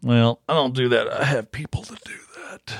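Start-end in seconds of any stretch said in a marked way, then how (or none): 1.1-2.7 whispering. Acted. Mock posh boast.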